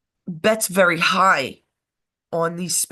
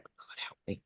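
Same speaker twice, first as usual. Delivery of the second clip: hushed whisper; breathy under-the-breath prayer; whispered aside; 21 dB quieter than usual. Real, not acted. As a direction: whisper it like a guilty confession. Confessing aside.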